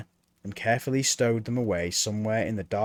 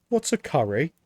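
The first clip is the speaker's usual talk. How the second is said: put-on higher, eager boy's voice; young Mort; 4 semitones higher than usual. A narrator's reading. Apprentice Mort questioning Death, higher boyish voice. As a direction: play the puzzled young apprentice.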